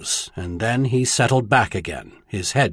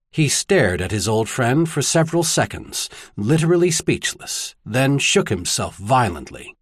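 usual speaking voice

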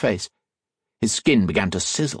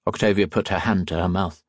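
ordinary delivery, nothing marked

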